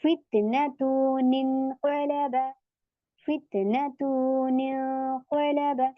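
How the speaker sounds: slow chanted recitation with a falling close; Quranic recitation. Shown as a demonstration. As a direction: draw the words out as in tajweed. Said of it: "इसी तरीके से यहाँ पे".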